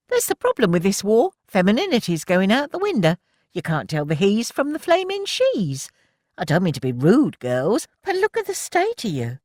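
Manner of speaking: high, loud indignant rant. A narrator's reading. Milly scolding the girls about femininity, high and indignant. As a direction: scold them for looking scruffy. Milly lecturing in voiced character.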